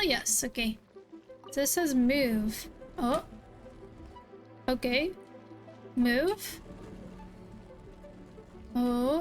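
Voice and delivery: ordinary talk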